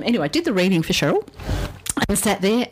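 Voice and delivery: ordinary delivery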